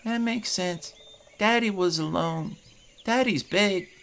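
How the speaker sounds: put-on high, earnest child voice; a little boy recalling the scary raid, pitched high; young boy. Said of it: "he looks at you and goes".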